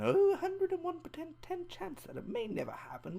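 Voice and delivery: sing-song parody of the careless mom; mocking mom voice. Vlogger parodies the mom in the film, high mocking voice.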